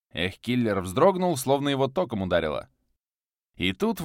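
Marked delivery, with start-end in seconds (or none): none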